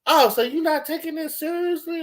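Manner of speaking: imitating the supervisor's high indignant scold; about to yell. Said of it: "she was gonna yell at me".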